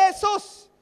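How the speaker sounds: high, loud cry of a name; shout. Retold or imitated. Blind beggar shouting for Jesus from the roadside, loud cry. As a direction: call out to get his attention. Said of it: "hat angefangen zu schreien".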